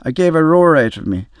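plain reading voice

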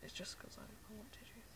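very soft whispered aside; whispering. Shown as a demonstration. Explaining the tissue request to viewers, whispering. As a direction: keep it to a faint whisper. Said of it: "if you hear me whispering".

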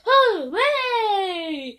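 loud sung-out cheer; shouted hooray. Pretend. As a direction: cheer it out loud, stretching the end. The song calls for shouting.

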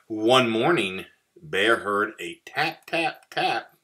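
ordinary delivery, nothing marked

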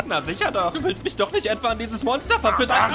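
ordinary talk, nothing marked

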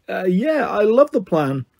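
hesitant, mildly nervous agreement; nervous gulp. A narrator's reading. Grover nervously agreeing to the plan, gulping. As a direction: stall on the first word, then agree too eagerly. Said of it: "Grover gulped".

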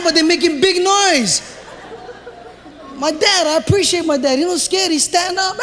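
usual speaking voice

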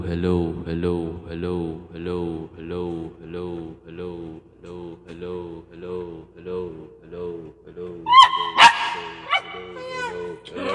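hellos fading into the distance; moving away from mic. Shown as a demonstration. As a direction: repeat hello while backing steadily away from the microphone. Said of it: "i-try naman natin yung, ah, palayo ng palayo".

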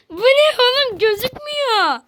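high-pitched, wailing complaint; exasperated whine. Real, not acted. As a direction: whine it out, dragging the last word. Frustrated at invisible game obstacles.

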